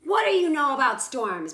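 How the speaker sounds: loud, gruff demand. Acted. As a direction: challenge the boy.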